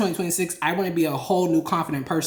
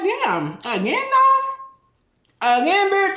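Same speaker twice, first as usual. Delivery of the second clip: exasperated disbelief; 12 semitones higher, slower than usual. Retold or imitated. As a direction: play up the disbelief, pitch jumping up. Acting out own exasperated reaction.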